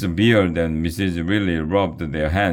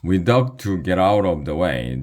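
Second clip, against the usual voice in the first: ordinary delivery, nothing marked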